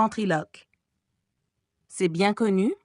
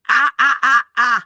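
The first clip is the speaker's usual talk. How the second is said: loud, higher ventriloquist laughter; mocking laugh; 4 semitones higher, 9 dB louder than usual. The monster laughing loud at Christine. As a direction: cackle out at the captive.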